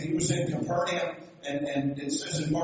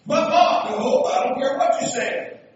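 loud, high-pitched acted argument; insistent overruling; 8 semitones higher, 10 dB louder than usual. Preacher acting out an argument with mom, loud and insistent. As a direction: hammer it out, brooking no objection. Acts out mother overruling her son's protest.

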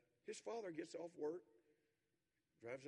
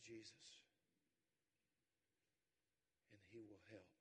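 reflective sermon close; 4 semitones lower, 7 dB quieter than usual. Acted. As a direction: draw the listeners in gently.

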